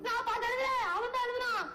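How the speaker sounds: high-pitched, aggrieved wail; wailing complaint. Acted. Humiliated poet blames the true author, wailing. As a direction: wail it out as a helpless excuse.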